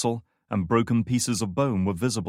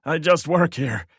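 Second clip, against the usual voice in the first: desperate pleading; 8 semitones higher, 7 dB louder than usual. A narrator's reading. Wounded guard pleading for life.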